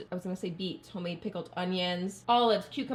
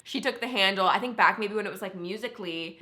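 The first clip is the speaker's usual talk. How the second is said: usual speaking voice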